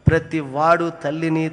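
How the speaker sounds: usual speaking voice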